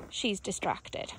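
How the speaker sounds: ordinary talk, nothing marked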